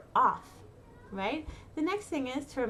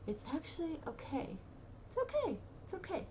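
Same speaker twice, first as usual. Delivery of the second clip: much softer, gentle comfort; soft reassurance; 5 semitones higher, 8 dB quieter than usual. Real, not acted. Soothing a frightened listener, soft and gentle. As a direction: ease them with a soft "It's okay".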